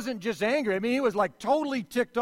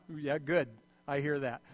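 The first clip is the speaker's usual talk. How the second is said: ordinary talk, nothing marked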